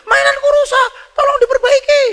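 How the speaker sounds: put-on loud, very high child's wail; whining child. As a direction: play a kid pestering Dad at full pitch. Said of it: "Anaknya merengek". (suara anak merengek)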